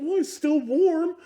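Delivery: put-on high, slow, matter-of-fact girl; girlfriend voice. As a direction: play her as unbothered. Girlfriend quoted.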